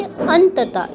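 अंततः pitched high and loud, n made clear; sounded n. Shown as a demonstration. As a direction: lean on the "an" of अंततः. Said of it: "इसमें किसकी sound आ रही है".

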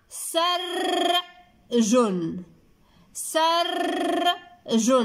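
سرج as a long high rolled sarrr, pause, jun; slow two-beat سرج with a trilled r; split into two syllables. Shown as a demonstration. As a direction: hold the r in سرج, then add jun separately. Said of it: "في جوج د المقاطع".

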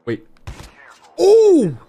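high, loud drawn-out cry; shocked cry. Real, not acted. Streamer shocked by a shot, high shocked cry. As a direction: let out a real, piercing cry of surprise.

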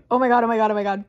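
loud, rushing string of exclamations; real disbelief. Real, not acted. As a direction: blurt it out loudly, aghast at the book. Exasperated at absurd detail so early.

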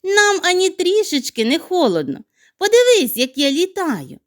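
high, bright snowflake voice; playful snowflake. Acted. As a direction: snowflake teasing the hedgehog. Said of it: "засміялася сніжинка".

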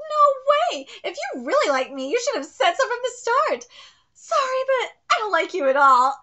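a high, loud character read of the rejection; first read of rejection. Shown as a demonstration. Reading a girl's cold rejection, a loud first take. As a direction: deliver the harsh rejection line. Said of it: "let me read this again".

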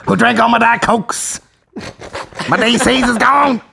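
high, indignant comic scolding; Dave Chappelle impression. Mother raging over cola and couch, high mock impression. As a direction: complain high and outraged for laughs. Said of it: "with the Dave Chappelle voice".

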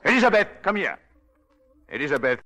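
loud, high call across the house. Acted. Husband calling the maid loudly from another room. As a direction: shout for the maid to come.

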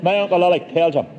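plain reading voice

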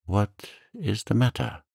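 put-on timid young man's concern. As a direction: ask cautiously, with a pause after "what". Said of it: "said Dante timidly".

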